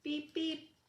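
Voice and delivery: light high beep-beep; gentle horn beep. A narrator's reading. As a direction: beep to comfort a friend. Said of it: "said little Blue".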